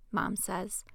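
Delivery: ordinary delivery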